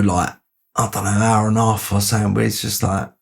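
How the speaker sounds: ordinary talk, nothing marked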